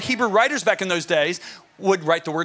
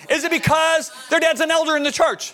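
higher, leading question; dad quizzing sons; 7 semitones higher than usual. Retold or imitated. Father testing his sons with silly options, leading. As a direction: pitch it up, baiting them for a no.

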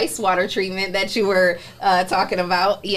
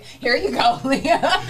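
higher, laughing retelling; mock handover; 7 semitones higher, slower than usual. Retelling coworker's coffee trick to the hosts, laughing mock handover. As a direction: imitate a handover while cracking up.

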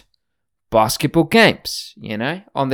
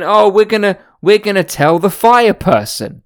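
put-on raised, louder whiny Valley Girl tattling; mocking the hikers; 7 semitones higher, 3 dB louder than usual. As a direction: ham up the snitchy LA hikers threatening to report you. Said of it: "And they were saying".

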